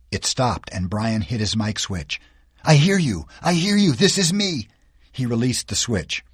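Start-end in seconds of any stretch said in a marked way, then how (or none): 2.6-4.7 higher, rushing to answer; boy finally hears someone on the radio, eager; eager relief. Acted. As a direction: grab the lifeline, voice lifting high.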